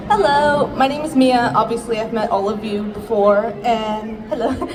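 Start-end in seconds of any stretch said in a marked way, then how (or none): none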